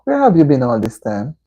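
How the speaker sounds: usual speaking voice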